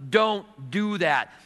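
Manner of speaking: very slow, heavy warning; slow firm warning. Acted. God stopping the sinner again, slow and heavy. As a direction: lean on each word, slowly.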